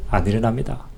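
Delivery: ordinary talk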